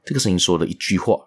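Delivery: usual speaking voice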